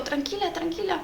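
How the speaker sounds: imitating the shop girl soothing her softly. Shop clerk calming panicking woman.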